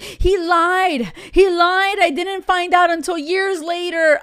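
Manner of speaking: hammed-up aggrieved complaint; complaining women. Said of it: "there's so many women like".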